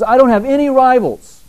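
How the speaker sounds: high, loud declaration; Jesus's words thrown out high and loud, as a preacher quotes him; proclaiming. Retold or imitated. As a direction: belt "I don't have any rivals" high and loud. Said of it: "He says".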